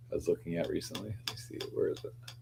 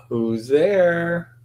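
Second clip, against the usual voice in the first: louder, higher, drawn-out call; 8 semitones higher, 7 dB louder than usual. Real, not acted. Host checking who just arrived, calling out. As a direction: call to someone out of sight.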